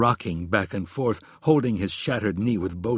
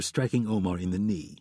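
usual speaking voice

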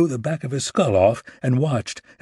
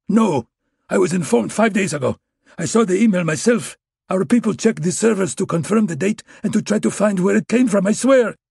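put-on Russian accent, much higher and louder, desperate; Russian agent begging for his life with a gun in his crotch, much higher and louder; panicked Russian pleading; 9 semitones higher, 4 dB louder than usual. A narrator's reading.

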